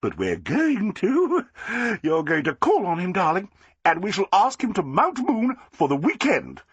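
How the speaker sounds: high, gushing society lady; Flossie, delighted. A narrator's reading. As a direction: crow with triumph, slowing at the end. Flossie planning eagerly.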